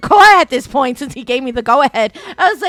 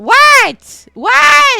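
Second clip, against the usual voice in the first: high, loud disbelieving shouts; hammed-up shocked yell; mock outrage; 12 semitones higher, 10 dB louder than usual. Mock outrage at normal pain.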